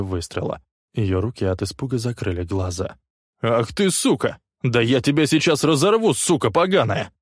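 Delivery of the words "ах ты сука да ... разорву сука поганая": loud, higher, snarling rage; furious growl. A narrator's reading. Leslie snarling at his attacker, loud furious growl. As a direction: roar the threat at him. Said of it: "прорычал Лесли".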